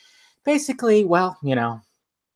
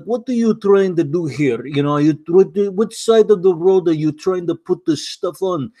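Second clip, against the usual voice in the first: imitated Bond-villain Russian accent; thick Russian accent; 3 semitones higher than usual. Imitating a transportation official on the phone in a thick Russian accent. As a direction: mimic a heavy Russian accent like a film villain. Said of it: "he had a thick Russian accent".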